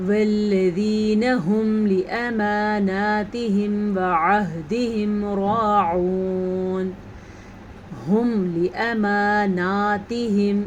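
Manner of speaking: slow melodic tajweed chant; Quran recitation. Shown as a demonstration. Teacher reciting verses for students, slow tajweed chant. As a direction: recite each word slowly and evenly.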